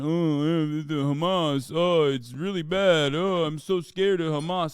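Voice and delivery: whiny sarcastic imitation; mock a fearful politician, hammed-up; mocking fake worry.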